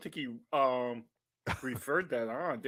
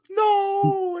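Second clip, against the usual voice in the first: mock no; 8 dB louder than usual. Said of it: "Can we all go, "No".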